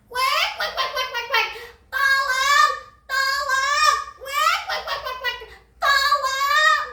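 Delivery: high-pitched squawking duck crying for help; panicked duck. Acted. Duck flees the hunting crocodile, panicked high squawk. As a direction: quack frantically in a high, loud sing-out.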